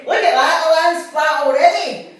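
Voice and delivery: high, loud excited shout. Retold or imitated. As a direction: shout it like a kid seeing the view. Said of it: "So when Jenny starts shouting".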